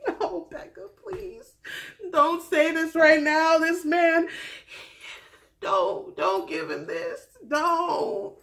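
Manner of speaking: high, loud, desperate begging; pleading with Becca. Retold or imitated. Vlogger pleading with Becca on screen, high loud begging. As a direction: beg her to stop right now. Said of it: "And I'm just like".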